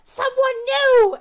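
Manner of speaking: high, slow squeal of delight; a tiny kobold shrieking with joy; excited kobold. Acted. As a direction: kobold spotting newcomers, high and thrilled.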